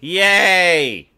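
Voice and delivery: hammed-up celebration; cheer. Mock cheer.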